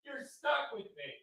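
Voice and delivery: very soft, high little repeat; hushed aside. Real, not acted. Host repeating it to viewers, hushed aside. Quiet repeat of his declaration.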